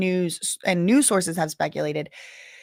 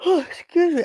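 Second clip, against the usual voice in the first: yawning; 10 semitones higher than usual. Real, not acted. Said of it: "I don't know why I'm yawning so much".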